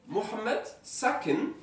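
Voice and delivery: Mohamed sakin said slowly and clearly for the class; slow model sentence. Shown as a demonstration. Teacher to class, modelling Mohamed sakin slowly. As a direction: spell out Mohamed sakin carefully. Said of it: "teacher models Arabic".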